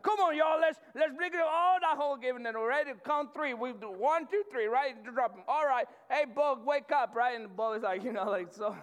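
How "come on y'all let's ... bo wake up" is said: loud, high rallying of the diggers; excited crew shouting. Retold or imitated. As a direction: count it off like a work crew. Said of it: "we're like".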